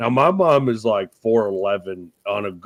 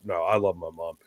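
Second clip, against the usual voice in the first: usual speaking voice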